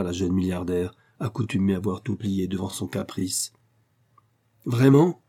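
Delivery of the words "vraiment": high, loud outraged cry; exclaiming. A narrator's reading. Aurora leaps up, crying out in anger. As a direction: fire it back in outrage. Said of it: "s'écria-t-elle".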